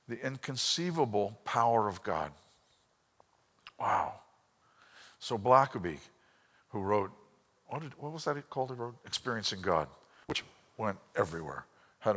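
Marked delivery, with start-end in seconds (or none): none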